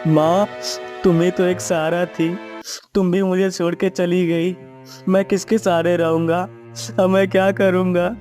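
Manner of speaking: low-pitched, grieving lament; grieving son. Acted. Son mourning his dead mother, grief-stricken. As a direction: lament as a bereaved, helpless son.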